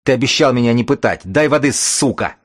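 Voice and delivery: fast, high, loud demand; desperate yell. Acted. Captive shouts at the bookman for water, desperate. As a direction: bark it out in desperation.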